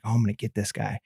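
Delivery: low, soft scheming aside; scheming mutter. Retold or imitated. Quietly scheming to prank the sick sound guy, mischievous. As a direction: plot the prank under your breath.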